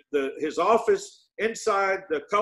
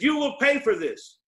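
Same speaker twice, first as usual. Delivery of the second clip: high-pitched threat, imitating a screamer without full volume; retold angry scream; 7 semitones higher than usual. Quoting the man who threatened him that morning, mock angry scream. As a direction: act out his furious threat, pitched up. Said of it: "He screamed at him and said".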